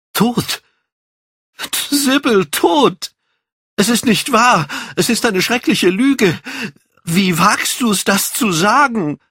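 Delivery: very high, horrified cry; anguished outcry. Acted. Dorian hears that Sybil is dead, anguished outcry. Dorian cries out at death news.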